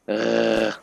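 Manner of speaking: a throat-clearing uvular rasp for "Ugh."; throaty French R. Shown as a demonstration. Showing how the French R is made: throat-clearing rasp. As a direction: scrape "Ugh." from the back of the throat. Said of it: "when you clear your throat".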